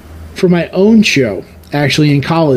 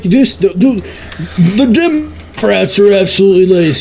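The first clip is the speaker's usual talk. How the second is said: higher, drawn-out mock pundit voice, starting very slow; talk radio host; 4 semitones higher, slower than usual. Parodying a lazy talk radio host ranting on air, higher mock voice. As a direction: lampoon a blustering right-wing radio host. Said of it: "Republican talk radio guy talking about how somebody's lazy".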